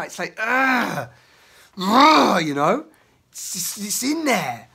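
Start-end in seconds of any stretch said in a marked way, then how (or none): 0.4-2.4 ugh. Real, not acted. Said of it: "in the expression right now".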